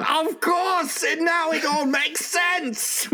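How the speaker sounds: put-on high, slow German accent; hammy German voice, drawn out. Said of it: "Any excuse to do a German accent".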